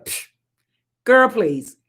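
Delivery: ordinary delivery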